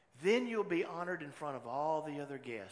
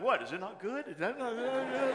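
higher, louder puzzled question with a laugh; baffled man worried he wrote it wrong; confused surprise; 12 semitones higher, 8 dB louder than usual. Retold or imitated. Mentee confused by the pastor's tears, surprised question.

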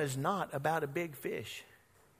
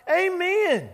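crowd cheering; 12 semitones higher, 7 dB louder than usual. Retold or imitated.

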